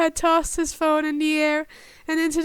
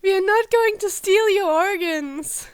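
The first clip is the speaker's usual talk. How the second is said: confused shout; 4 semitones higher, 5 dB louder than usual. Acted. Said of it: "Dick shouted back, confused".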